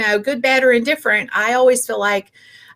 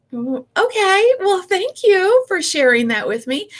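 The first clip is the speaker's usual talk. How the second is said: forced politeness; 8 semitones higher than usual. Retold or imitated. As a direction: smile through the sting of criticism. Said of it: "And I'm like".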